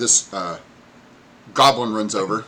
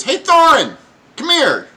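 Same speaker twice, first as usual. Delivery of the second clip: high, loud call across the dock; 11 dB louder than usual. Acted. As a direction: shout for Thorin in a goblin squeal. Goblin calls kobold.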